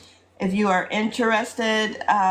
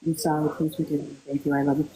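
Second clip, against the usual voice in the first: tender whispered-down repetition; soft mantra; 5 semitones lower, 3 dB quieter than usual. Shown as a demonstration. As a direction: lower and softer, sinking toward a hush. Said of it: "It's just".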